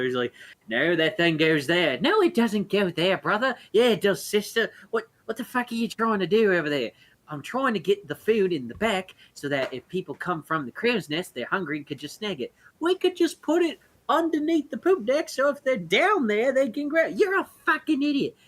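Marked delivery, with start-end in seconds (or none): 0.7-4.7 higher, louder squabbling sea elf; bickering siblings. Acted. Sea elf siblings arguing over barrels, in a high bickering voice. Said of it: "constantly arguing and bickering". 4.9-12.5 bickering sibling. Acted. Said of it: "constantly arguing and bickering". 12.8-18.4 much higher, louder squabbling elf; bickering sea elf. Acted. Sister sea elf insulting her brother, high and squabbling. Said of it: "constantly arguing and bickering".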